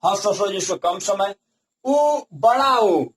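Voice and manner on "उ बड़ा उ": उ बड़ा उ said slowly, vowels set apart; vowel length demo. Shown as a demonstration. Teacher comparing vowel timing for students, slow उ बड़ा उ. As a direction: sound out each उ slowly. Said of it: "बहुत ही कम समय लग रहा है".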